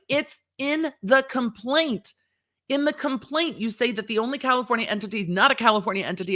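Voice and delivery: exasperated mock judge, pitched high; voicing the court. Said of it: "And the court's like".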